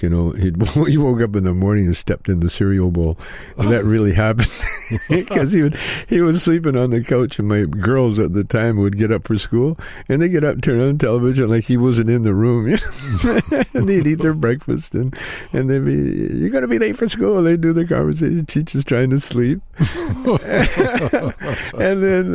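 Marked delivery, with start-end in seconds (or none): 0.3-16.4 laughing while telling. Real, not acted. Laughing while telling cereal story. 16.4-17.4 high, quick imitation of the daughters; high mock girl voice. Girls' voices quoted. 17.4-22.3 laughing while telling. Real, not acted. Laughing while telling cereal story.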